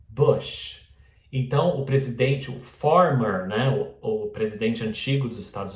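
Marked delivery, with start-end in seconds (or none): 0.1-0.9 lax middle vowel in "bush"; correct pronunciation. Shown as a demonstration. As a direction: say "bush" between oo and oh. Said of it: "É bush". ("bush" entre U e O)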